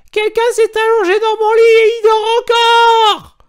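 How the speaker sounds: very high, very loud squeaky cub shriek; little bear's tiny voice. A narrator's reading. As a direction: shriek like a cub finding a stranger asleep. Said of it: "s'écria de sa petite voix". (petite voix d'oursonne, très aiguë et très forte)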